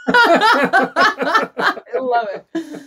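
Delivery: slow, drawn-out words through real laughter; laughing. Real, not acted. Guest delighted by her own phrase, laughing.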